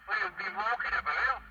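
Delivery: ordinary talk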